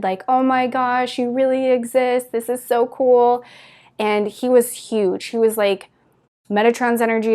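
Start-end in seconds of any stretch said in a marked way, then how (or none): 0.3-3.4 put-on higher-pitched, lightly childlike excitement; excited little kid. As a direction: retell it with a touch of starstruck glee. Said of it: "just like a little kid, excited".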